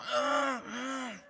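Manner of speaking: high strained mock cries of a woman pushing; acted birth-pushing gasps; straining grunts. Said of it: "Y ella pujaba".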